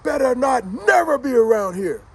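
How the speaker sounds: loud, high, angry warning; father yelling. Retold or imitated. Father yells as his son comes back out, retold angry shout. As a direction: imitate a dad shouting a ban. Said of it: "the last part I heard his father say".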